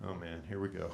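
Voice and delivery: soft, low, resigned mutter under the breath; quietly dreading what comes next; resigned groan. Retold or imitated. Dad bracing for his daughter's criticism, a soft resigned mutter.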